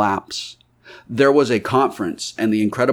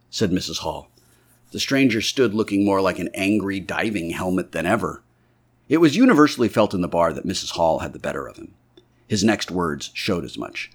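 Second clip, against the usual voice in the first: ordinary delivery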